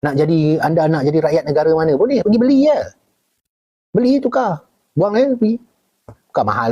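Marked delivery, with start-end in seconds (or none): 1.9-5.6 excited. Real, not acted. Excited point about buying citizenship.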